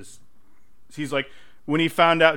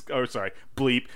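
ordinary delivery

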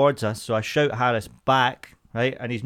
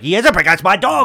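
higher and louder, indignant on the dog's behalf; mock protective outburst; 8 semitones higher, 7 dB louder than usual. Wife defending her dog from the cyclist, loud indignant. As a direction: snap back like a protective owner. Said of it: "But she was like".

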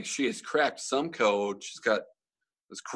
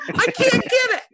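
put-on very high, helpless old lady voice; flustered old mom; 5 dB louder than usual. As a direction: squeak it like a lost grandma. Imitating old mom with phone.

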